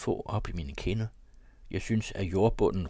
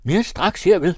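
higher, much louder call from the innkeeper; raised, high-pitched shout; eager shout; 10 semitones higher, 10 dB louder, slower than usual. A narrator's reading. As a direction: shout it out as an obliging host. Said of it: "råbte værten".

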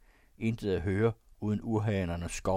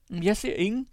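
very high, raised-voice exclamation; called out; 13 semitones higher, 6 dB louder than usual. A narrator's reading. Fleeing woman looking back for the riders, calling out. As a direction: call it out high and loud. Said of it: "råbte hun".

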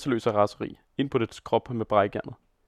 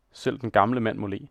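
usual speaking voice

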